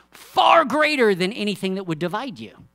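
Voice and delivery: loud, high, rising sermon voice; preaching at full force. Acted. Preacher rousing the church about harmony, full force. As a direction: preach it out to the whole congregation.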